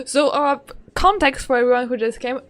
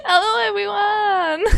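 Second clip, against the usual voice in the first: excited hello; 8 semitones higher than usual. Real, not acted. Greeting viewers.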